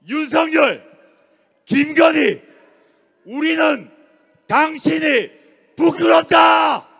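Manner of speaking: loud, high shout, pausing between phrases; rallying shout. Real, not acted. Orator addressing the presidential office in Yongsan, rallying shout. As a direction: build to a real full-voiced shout of condemnation.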